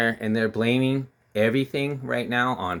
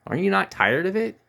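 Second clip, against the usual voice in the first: exasperated; 11 semitones higher than usual. Real, not acted. Vlogger asking viewers if they're fed up, high exasperated cry. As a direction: pitch shoots up in fed-up disbelief.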